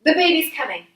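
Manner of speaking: high, loud, urgent announcement; retold urgent news. Midwife at the door announcing the birth, high and urgent. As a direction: call it out as if rushing out.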